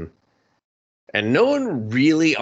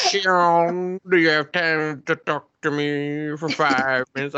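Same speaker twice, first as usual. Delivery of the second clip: creepy detective; 6 semitones higher than usual. Retold or imitated. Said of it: "Detective Trashbag's like".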